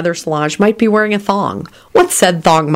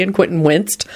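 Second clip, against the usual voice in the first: plain reading voice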